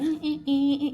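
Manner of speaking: high, dismissive mock eh eh eh; imitating a sassy mentor waving her off; sassy scoff. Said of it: "He's real sassy".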